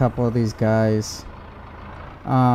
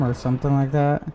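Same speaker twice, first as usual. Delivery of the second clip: usual speaking voice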